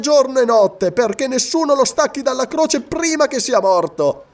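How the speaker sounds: high-pitched, jovial gloating; cheerful tone. A narrator's reading. Roman gloats over the crucified Pict, cheerful tone. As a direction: call it out merrily, pitched well above normal. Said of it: "disse in tono allegro Silla".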